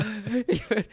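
high laugh trailing down. Real, not acted. Host laughs at the new meaning of fresh in India, high fading laugh. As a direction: let the laugh spill out and fade.

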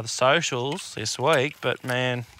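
normal talking voice

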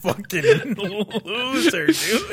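high cracking laugh through the words; laughing insult. Real, not acted. Friend laughing while insulting the victim, high laughing jeer. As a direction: giggle out the insult.

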